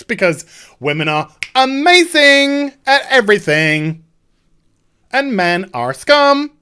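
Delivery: hammed-up sloganeering with stretched words; mock triumphant crowing. Mocks the heroine's crowing slogans.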